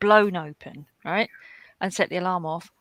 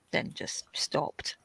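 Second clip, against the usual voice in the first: softer whispered aside; 9 dB quieter, much slower than usual. Real, not acted. Telling a viewer she quit the parties, in a whisper. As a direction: drop to a breathy secret whisper.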